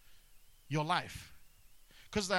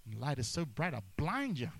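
ordinary delivery

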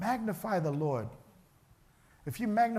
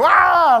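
high, very loud scream; panicked yell; 12 semitones higher, 17 dB louder than usual. Acted. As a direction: cry out in panic.

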